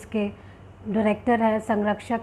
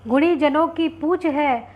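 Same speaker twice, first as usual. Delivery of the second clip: slow, raised, projected verse; couplet recitation; 4 semitones higher, 4 dB louder, slower than usual. Acted. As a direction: recite a doha to an audience, each line measured. (ऊँचे स्वर में धीमा दोहा पाठ)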